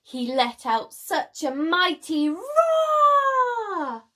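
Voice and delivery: drawn-out dramatic roar; mighty roar. A narrator's reading. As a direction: bellow it out like a dinosaur. Narrating Sid's mighty roar.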